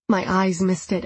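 ordinary delivery, nothing marked

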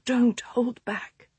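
slow hoarse whisper. A narrator's reading. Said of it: "she whispered hoarsely".

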